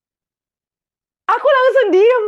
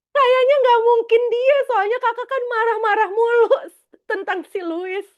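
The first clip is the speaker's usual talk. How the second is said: much higher, loud mocking brother voice; teasing little brother; 10 semitones higher, 5 dB louder than usual. The younger brother teases his sister about Louis, high and mocking. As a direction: play the wide-eyed brother teasing her. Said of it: "adik laki-lakiku melotot gini".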